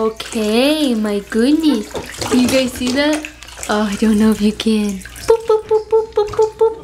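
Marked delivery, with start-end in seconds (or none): 5.3-6.8 high, playful little boops; playful baby talk. Pretend. Playing with the baby.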